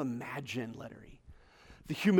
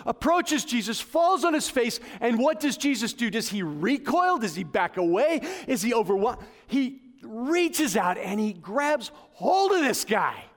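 passionate preaching; 8 semitones higher, 8 dB louder than usual. Acted. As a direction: drive the story home from the pulpit. Preaching the healing story.

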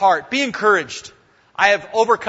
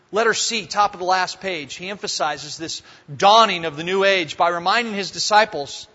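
usual speaking voice

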